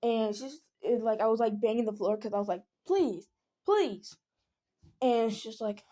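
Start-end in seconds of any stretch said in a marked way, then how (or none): 2.9-4.2 higher, insistent begging; exasperated retold begging; retold pleading. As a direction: plead in two separate high bursts. Begging while banging the floor.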